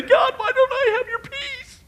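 very high, drawn-out mock whine; exaggerated whiny plea; whining complaint. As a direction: wail it up to God in a thin falsetto. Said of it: "we whine and we complain".

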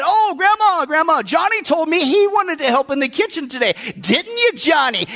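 high-pitched mock eager girl; scheming little sister. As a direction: pipe up eagerly as a scheming child. Sally tattles sweetly on her brother.

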